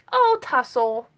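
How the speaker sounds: ordinary delivery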